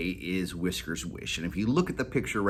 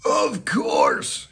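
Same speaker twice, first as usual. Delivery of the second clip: high, loud breathy exclaim; excited cry; 9 semitones higher, 6 dB louder than usual. Acted. Molly crying out in discovery, high. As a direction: burst with the idea. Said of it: "she cried".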